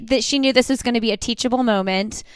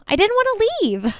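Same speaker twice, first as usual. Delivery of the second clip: put-on whiny child voice; 10 semitones higher, faster than usual. Admits not wanting to leave park.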